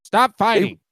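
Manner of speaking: sharp, high mock-mom snap; scolding mom. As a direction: play the fed-up mother telling kids off.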